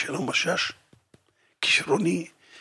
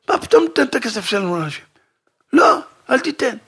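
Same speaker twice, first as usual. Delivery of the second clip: fast, raised objection; indignant protest; 5 semitones higher, 5 dB louder, faster than usual. Acted. Refusing to give the family money away, indignant. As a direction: refuse it outright, rushing. Quarrel over giving money.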